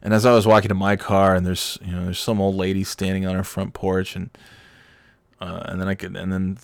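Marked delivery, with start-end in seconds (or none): none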